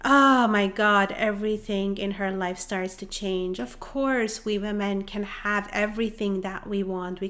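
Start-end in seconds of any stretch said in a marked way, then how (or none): none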